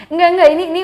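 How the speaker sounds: laughing, high and loud no-no; laughing denial. Real, not acted. Laughing as the host teases her old photo. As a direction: brush off the compliment with a laugh.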